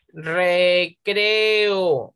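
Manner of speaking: "Recreo" drawn out as re-cre-o; slow syllable-by-syllable "Recreo", final O on its own; split into syllables. Shown as a demonstration. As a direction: pull E and O apart in "Recreo". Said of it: "No pueden estar juntas".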